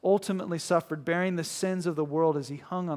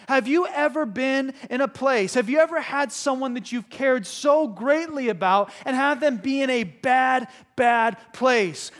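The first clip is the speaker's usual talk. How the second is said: higher, louder pulpit voice; full-force preaching; 8 semitones higher, 7 dB louder than usual. Real, not acted. Preacher pressing the congregation about helpless suffering, full force. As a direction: drive it home to the congregation, emphatic.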